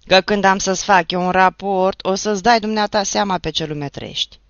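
loud, mocking threat; mocking shout. Toderici threatens the neighbour, shouting mockingly. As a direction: shout it back, mimicking his speech. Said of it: "strigă Toderici".

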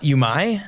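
"Yumai" said yoo-MY, rising as a question; alternative pronunciation. Shown as a demonstration. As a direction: end "Yumai" on an "eye" sound. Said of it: "the U as two O's".

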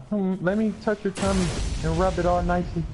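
high, gentle teasing voice; soothing mock voice. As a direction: coo it like comforting a child. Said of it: "Other times it'd be like".

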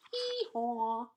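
drawn-out imitated hee-haw; donkey bray. Donkey's bray in read-aloud story.

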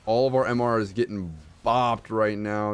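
ordinary talk, nothing marked

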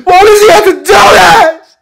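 high, loud wailing sobs; crying. Acted. Boy sobbing loudly after being kicked out. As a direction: wail and sob at the top of the voice. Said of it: "Yeah, I was crying".

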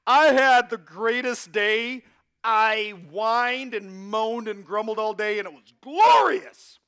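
loud, high mock elation with drawn-out words; over-the-top excitement. Preacher mocking someone who loves grumbling, hammed-up excitement. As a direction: crow it slowly, hugely hammed-up. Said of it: "gets really excited like".

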